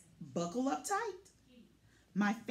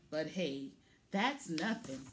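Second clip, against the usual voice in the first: plain reading voice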